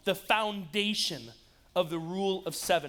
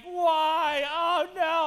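mock crying; 7 semitones higher, 5 dB louder, much slower than usual. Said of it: "I'm, like, crying".

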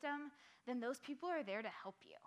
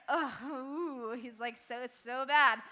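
disgusted; 10 dB louder, slower than usual. Acted. Youth leader reacting to David's sin.

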